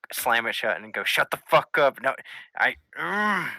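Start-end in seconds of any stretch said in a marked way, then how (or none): 1.0-3.5 loud, high snap at the book; old man yelling at his talking book, loud and high; angry yell. Acted.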